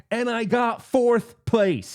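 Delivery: loud, high, slow mock outrage; retold ranting. Sore player confessing his result to his friend, mock outraged. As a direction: drag out each word in hammed-up fury. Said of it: "now I'm ranting on him".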